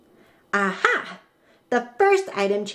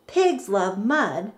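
ordinary delivery, nothing marked

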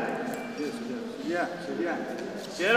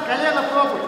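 normal talking voice

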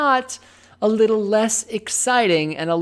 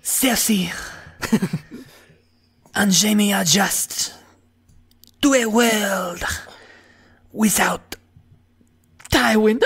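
soap opera narrator. Pretend. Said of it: "Spanish soap opera?".

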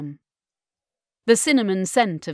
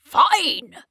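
high, loud bitter snap; Davros voice; 8 semitones higher, 6 dB louder than usual. Acted. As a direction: spit it out bitterly. Davros voice rising.

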